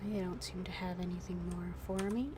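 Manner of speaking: normal talking voice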